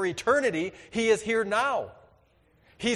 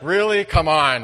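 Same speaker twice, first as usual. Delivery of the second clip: exasperated wife; 9 dB louder than usual. Retold or imitated. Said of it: "Tina's like".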